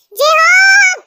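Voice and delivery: loud, high furious yell of yes; angry shout. Acted. Mother yelling at her late-sleeping sons, a loud high shout. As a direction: bellow it across the house at the lazy kids.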